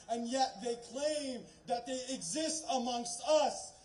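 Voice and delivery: ordinary talk, nothing marked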